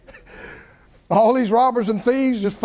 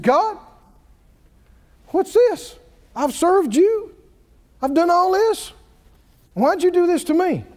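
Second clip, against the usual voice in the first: higher, pleading protest at God; mad Job; 7 semitones higher than usual. Retold or imitated. Preacher voicing Job angry at God, higher and pleading. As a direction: Job crying out against God, pitched up. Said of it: "And then he got mad".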